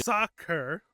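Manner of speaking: loud, outraged soccer; loud mock-offended retort; indignant outburst. Real, not acted. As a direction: bark it back in disbelief. Indignant at "It's football.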